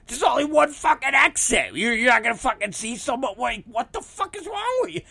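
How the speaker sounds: high-pitched incredulous outburst, rushing on; exasperated rant. Retold or imitated. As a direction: argue back, voice climbing high in disbelief. Heated retort to his wife.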